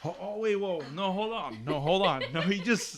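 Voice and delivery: high-pitched stunned stammer; shocked disbelief. Real, not acted. Cutting off his friend's story in high, stunned disbelief. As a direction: stop her mid-story in shock. Hearing the fiancé came out.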